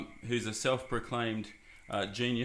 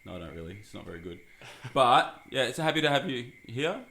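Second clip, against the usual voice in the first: ordinary talk, nothing marked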